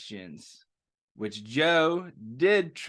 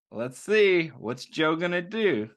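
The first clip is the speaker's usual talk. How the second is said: ordinary delivery, nothing marked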